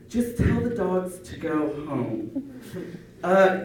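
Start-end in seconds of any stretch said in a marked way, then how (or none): 0.1-2.5 dismissive moms. Retold or imitated. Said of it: "the moms would say".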